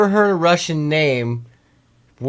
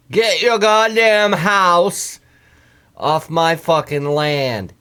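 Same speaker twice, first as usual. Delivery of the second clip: loud, high, drawn-out holler; mock angry landowner; 5 semitones higher, 4 dB louder, slower than usual. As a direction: slowly yell an order like an irate landowner. Retold angry landowner, mock-menacing.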